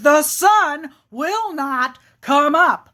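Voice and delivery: insistent bear. Acted. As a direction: drag out each word and punch the harumph. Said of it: "And the bear says".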